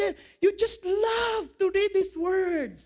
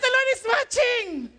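raised, high-pitched insistent reminder; emphatic retort; 6 semitones higher, 4 dB louder than usual. Retold or imitated. Husband and wife bickering playfully, loud emphatic retort. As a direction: insist on it, voice lifted.

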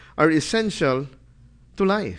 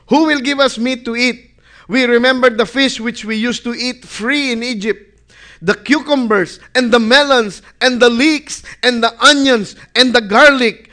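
loud, higher, longing list of Egyptian food; whining Israelites; 5 semitones higher, 6 dB louder than usual. Acted. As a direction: crave the cucumbers and melons aloud. Voicing the weeping, greedy Israelites.

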